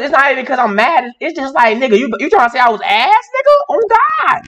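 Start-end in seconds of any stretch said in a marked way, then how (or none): 1.8-4.4 indignant outburst. Acted. Streamer fumes to chat about the award, voice shooting high. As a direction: pitch shooting way up in outrage. Outraged at most improved award.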